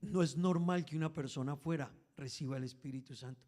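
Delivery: ordinary delivery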